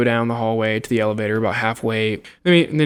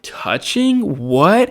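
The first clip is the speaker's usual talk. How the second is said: shocked disbelief; 7 semitones higher, much slower than usual. Retold or imitated. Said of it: "And then I'm like".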